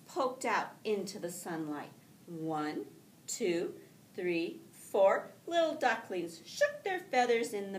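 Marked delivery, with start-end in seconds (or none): none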